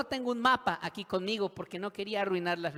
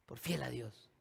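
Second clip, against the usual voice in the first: low, softened admission; subdued answer; 4 semitones lower, 6 dB quieter than usual. Acted. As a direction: repeat it quietly, deflated. [name] repeats answer, deflated.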